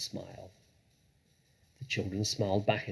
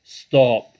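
very loud, sudden shout; booming protest; anguished cry; 3 semitones higher, 16 dB louder than usual. A narrator's reading. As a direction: cut in with a big pleading shout. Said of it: "cried Badger".

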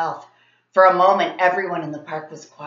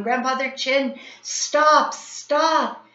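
higher, urgent shouts; shouting while running; 7 semitones higher, 4 dB louder, much slower than usual. A narrator's reading. Kids running uphill shouting to grandfather, urgent. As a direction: yell it on the run. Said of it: "running and shouting".

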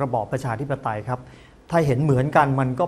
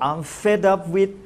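very slow, stretched, higher, rising at the end; slow demo phrase; 5 semitones higher, much slower than usual. Shown as a demonstration. Teacher showing TV viewers the phrase, very slow and rising. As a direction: spell out "I'm fed up with" slowly and deliberately, ending on a rise. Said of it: "เขาก็บอก".

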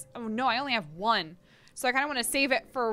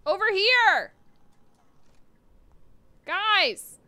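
calling out; 7 semitones higher, 5 dB louder, slower than usual. Real, not acted. Calling enemies over.

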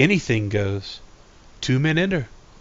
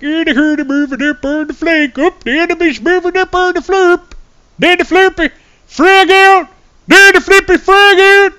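much higher, louder Swedish Chef calling out enemy moves; Swedish Chef soldier; 8 dB louder than usual. Pretend. Imagining a Milsim stream, calling the flank as the Swedish Chef. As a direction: play a Muppet chef on a military radio. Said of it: "talk like the Swedish chef".

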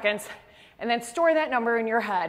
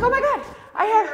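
excited gasp; 8 semitones higher than usual. Retold or imitated. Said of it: "I'm like".

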